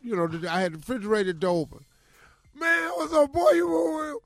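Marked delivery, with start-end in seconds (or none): 2.5-4.1 high, loud shouting at a friend on the phone; blasting his buddy. Retold or imitated. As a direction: yell it down the line, ribbing hard. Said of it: "I'm blasting him".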